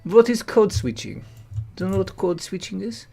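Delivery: pompous mock French intellectual; English in a thick put-on French accent. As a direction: present it like a French TV host.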